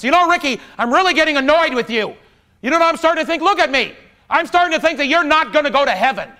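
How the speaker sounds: high, loud mock rant; brother losing it. Brother scolding Ricky at Thanksgiving, loud mock rant. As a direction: tear into him like the annoyed brother. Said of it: "he lost it. And he just tore into me".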